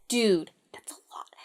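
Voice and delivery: hushed, unvoiced side comment; whispered aside. Real, not acted.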